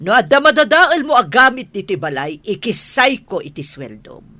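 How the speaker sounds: loud, forceful scolding; scolding boss. Acted. Boss warning the help about docking pay, loud scolding. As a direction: burst out loudly, then come down in pitch.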